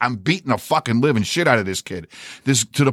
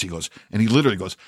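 ordinary talk

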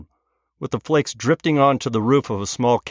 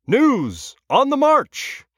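booming, much higher newsreel announcer; old radio announcer; 13 semitones higher, much slower than usual. Acted. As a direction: proclaim it like a newsreel opener. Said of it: "an imperious voice".

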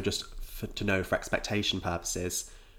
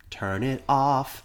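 slow, raised mock order; mock command; 6 semitones higher, 5 dB louder, much slower than usual. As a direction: drag it out like an order.

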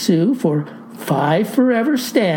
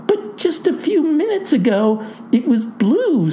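higher-pitched, baffled protest; stunned; 7 semitones higher than usual. A narrator's reading. Clerk confronts farmer about his hair, stunned. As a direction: protest in high-voiced disbelief. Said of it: "says the double-stunned gal".

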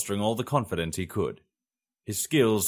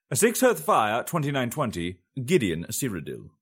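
date heading; 4 semitones higher, 3 dB louder than usual. A narrator's reading. Narrator opening a new chapter, announcing the date. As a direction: announce the date, louder and higher on Hearth.

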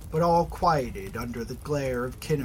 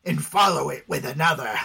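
scoffing; 3 semitones higher, 4 dB louder than usual. Acted. Corrado scoffs.

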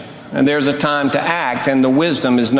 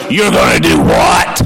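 deep, booming demon shout; demonic voice; 13 semitones lower, 6 dB louder than usual. Retold or imitated. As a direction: roar it like a possessed demon. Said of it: "I said in a demonic voice".

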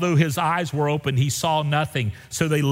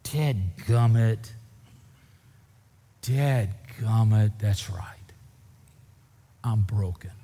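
rueful realization; 2 semitones lower, slower than usual. Retold or imitated. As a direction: let the admission sink in, defeated. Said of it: "all of a sudden realize".

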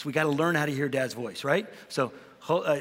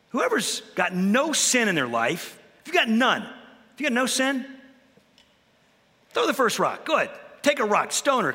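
a daring dare to the crowd; bold challenge; 6 semitones higher than usual. Retold or imitated. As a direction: call their bluff boldly. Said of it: "The nerve, the guts on him".

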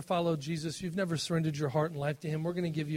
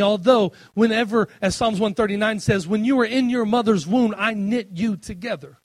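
loud, higher preaching voice; preaching at full force; 5 semitones higher, 8 dB louder than usual. Acted. Preacher quoting the psalm to the congregation at full force. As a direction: lift the psalm up to the room.

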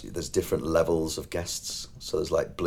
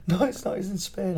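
mock correcting guests; 12 semitones higher, 3 dB quieter than usual. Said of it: "they go like".

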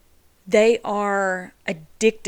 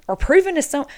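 louder, higher, pushing the point; raised voice; 6 semitones higher, 6 dB louder, faster than usual. Real, not acted. Talking to viewers about Sarah, raising her voice. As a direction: start to get worked up.

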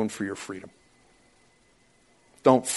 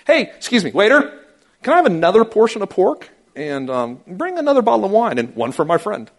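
flaunting diner; 9 semitones higher, 8 dB louder than usual. Retold or imitated. Mock diner flaunting freedom.

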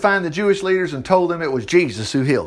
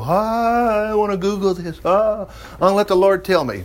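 mock dreamy musing; 2 semitones higher than usual. Preacher mimics people who stop reading, mock musing. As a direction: imitate someone idly musing, stretching the "Ah".

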